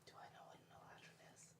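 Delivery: whispered aside. Real, not acted. Player whispering a rules question to the GM. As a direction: hush it out of character.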